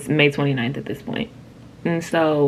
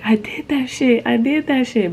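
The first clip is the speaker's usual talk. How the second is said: higher, amazed repeating; triumphant disbelief; 7 semitones higher than usual. Retold or imitated. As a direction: relive the proud shock of it. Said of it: "all I kept saying was like".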